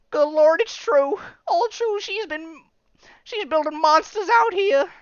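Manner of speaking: high, loud Southern hillbilly shout. Retold or imitated. Frightened townsman spotting monsters, high loud hillbilly voice. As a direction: sell it like a scared yokel, Southern twang.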